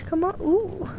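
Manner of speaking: normal talking voice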